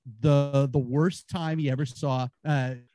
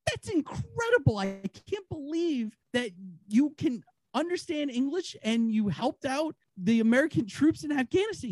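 much higher, gushing disbelief; amazed buyer; 10 semitones higher than usual. Acted. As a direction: play the buyer floored by the dog's story.

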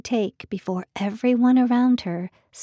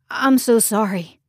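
gasped apology; 6 dB louder than usual. Acted. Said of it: "he gasped".

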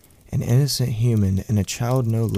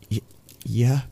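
put-on higher-pitched boy stumbling on the word; stammered; 4 semitones higher than usual. A narrator's reading. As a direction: stammer a nervous child's answer. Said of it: "the boy stammered".

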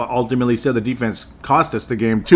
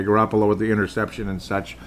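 normal talking voice

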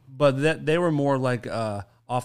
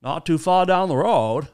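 Judd; 5 semitones higher, 3 dB louder than usual. Acted. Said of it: "he says stuff like".

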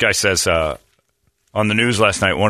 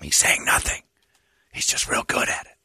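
whispering; 6 dB quieter than usual. Acted. Whispered aside in acted exchange.

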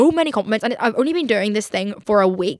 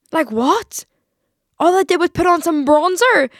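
incredulous; 7 semitones higher, 6 dB louder, slower than usual. Real, not acted. Disbelief at the gushing comments.

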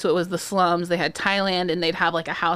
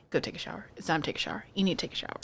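softer, tired motherly order; imitating mom sending a cranky kid to shower, softer; mom's command; 3 semitones lower, 12 dB quieter, much faster than usual. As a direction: quietly tell the kid to shower. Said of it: "she'd be like".

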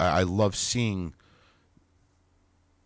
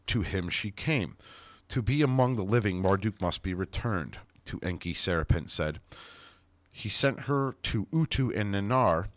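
ordinary delivery

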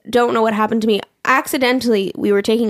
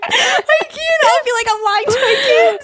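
high and loud, crying with laughter; 3 dB louder, slower than usual. Real, not acted. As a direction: push the words out through tears of laughter. Laughing so hard she cries.

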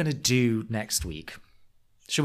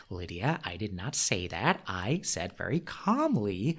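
mock calm insistence; 5 semitones lower, 7 dB quieter than usual. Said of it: "Shannon's like".

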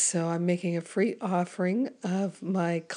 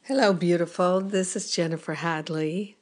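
hoarse, croaky voice. Real, not acted. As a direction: talk through a croaky throat. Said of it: "with a croaky voice".

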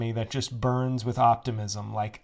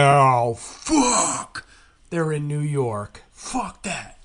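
hammed-up eagerness crashing into a loud "fuck"; mock excited fan; 3 semitones higher, 6 dB louder, slower than usual. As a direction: play an excited fan whose hopes suddenly collapse. Acts out eager fan, then let down.

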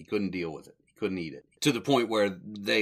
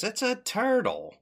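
mock Curly; 14 semitones higher than usual. Curly quote, big pitch jump.

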